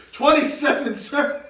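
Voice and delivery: shouted "yes sir" answer; loud eager recruit. Retold or imitated. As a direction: bark it like a rookie. Knuckles answering Joey's question.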